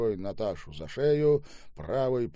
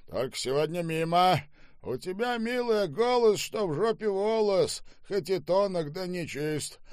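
loud, high-pitched theatrical declaiming; coarse, bullying Штаубе; 10 semitones higher, 4 dB louder than usual. Acted. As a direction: hold forth like a boorish drunk master. (грубый, напористый Штаубе, с декламацией)

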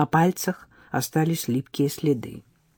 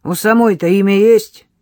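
louder, blunt rude question; gruff question; 4 semitones higher, 9 dB louder than usual. Acted. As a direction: ask it rudely. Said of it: "грубовато поинтересовался Егор".

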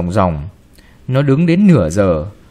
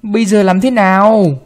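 higher, louder accusing question; grandma blaming the girl, raised voice; angry demand; 7 semitones higher, 4 dB louder than usual. Acted. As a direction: throw the blame at her. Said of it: "bà hoạnh".

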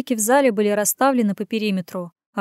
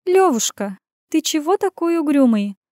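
higher, drawn-out teasing; sing-song drawl; 6 semitones higher, 4 dB louder than usual. Acted. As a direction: stretch it out, teasing sister. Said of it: "нараспев протянула".

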